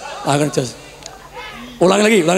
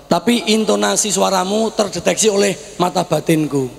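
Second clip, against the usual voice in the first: ordinary talk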